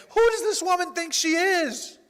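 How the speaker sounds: high, loud, outraged quote; mock indignation. Preacher mocking himself trying to be God, indignant. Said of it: "trying to be God and saying".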